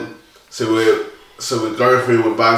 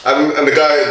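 usual speaking voice

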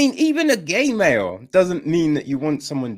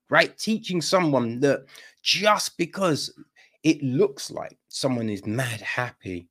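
usual speaking voice

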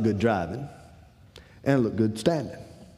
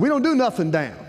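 high, slightly louder salesman voice; mock salesman; 11 semitones higher, 4 dB louder than usual. Salesman brushing off the customer, a high mock voice. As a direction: toss off the dealer's line in a higher voice.

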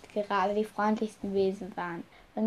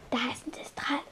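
whisper; 3 semitones higher, 7 dB quieter than usual. Acted. Luna warns in the dark.

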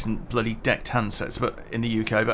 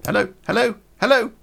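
lightly voiced wife calling hello; mild high-pitched hello into a dead phone; wife on a broken phone; 10 semitones higher, faster than usual. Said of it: "Heather picked the phone up and went".

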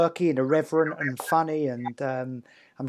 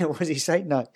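ordinary talk

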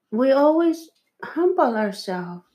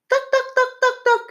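nagging chatter; faster than usual. Retold or imitated. Mimicking a nagging partner.

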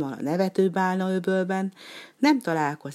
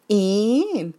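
coy; 5 semitones higher, 6 dB louder than usual. Acted. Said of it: "kacsintott kacéran".

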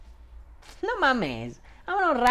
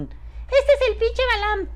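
very high, slow squealing fan voice; excited fan; slower than usual. Retold or imitated. A fan spotting him at an event, high excited squeal. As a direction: gush like a starstruck fan. Fans recognizing him in public.

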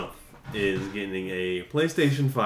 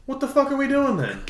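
exasperated; 14 semitones higher than usual. Retold or imitated. Exasperated retort to interviewer.

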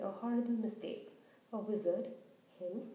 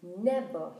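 louder, booming Never; thundered; 4 semitones lower, 7 dB louder than usual. A narrator's reading. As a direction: thunder it out with fury. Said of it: "he thundered".

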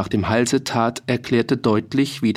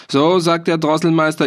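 a hint of a gloating hangman; loud, higher, relishing the count; mild hangman voice; 6 semitones higher, 4 dB louder than usual. Soldier imitates the hangman at the gallows.

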